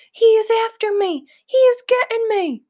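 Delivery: high, loud terrified giant's scream; a dreaming giant begs for rescue. A narrator's reading. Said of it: "screamed the flesh lump eater".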